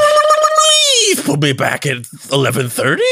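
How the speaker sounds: very high, spluttering cartoon rabbit voice; Roger Rabbit. Host signing off until eleven thirty, spluttering cartoon rabbit impression. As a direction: splutter the first word, then drag it out zanily. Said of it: "that was a Roger Rabbit voice".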